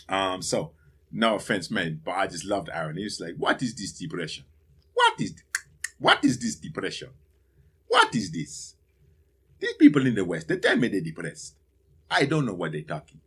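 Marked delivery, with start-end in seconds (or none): 3.4-13.2 put-on lightly done Sierra Leonean accent, higher and louder on the questions; a hint of a Sierra Leonean coworker, incredulous; Aaron's Sierra Leonean accent. Aaron from Sierra Leone scoffing at Western depression, Sierra Leonean accent. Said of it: "I'm doing an impression of Aaron".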